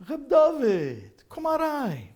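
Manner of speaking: high, loud, slow, joyful call to come in; warm elder welcome. Retold or imitated. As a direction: welcome him in like a beaming old rebbe. Rebbe warmly welcomes him in.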